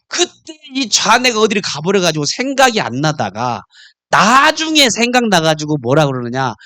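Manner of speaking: usual speaking voice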